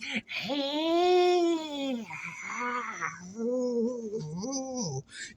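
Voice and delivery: long, wavering zombie wail; zombie moan. Pretend. Playing a zombie for viewers, long silly moan. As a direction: hold a high mock-undead moan, sliding along. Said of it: "why does a zombie do that".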